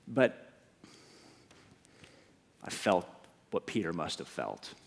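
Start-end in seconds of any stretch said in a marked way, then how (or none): none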